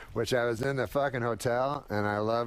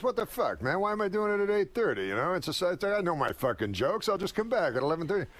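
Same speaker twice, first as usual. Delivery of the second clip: higher, fast grumbling complaint; Rodney complaining; 5 semitones higher than usual. Retold or imitated. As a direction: gripe about the early show.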